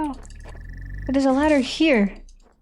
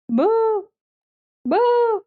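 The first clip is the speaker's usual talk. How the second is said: booing heckler; 9 semitones higher than usual. Pretend. Heckler booing robot comic.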